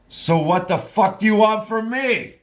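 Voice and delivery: sudden furious high shout; angry outburst. Acted. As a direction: snap back at her, loud and bitter. Sad woman snaps at Laura.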